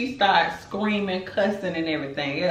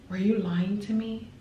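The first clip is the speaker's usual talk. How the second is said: quiet questioning; 4 semitones higher than usual. Retold or imitated. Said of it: "exactly how it played out".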